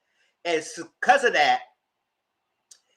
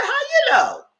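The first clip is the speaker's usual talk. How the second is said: very high, louder astonished cry; shocked quip; 6 dB louder, slower than usual. Real, not acted. Speaker reacting to Tadell's accusation, shocked. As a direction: squeal it in disbelief.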